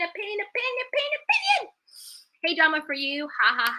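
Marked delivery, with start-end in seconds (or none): 0.0-1.7 echo effect. Pretend. Playful fading echo of "opinion.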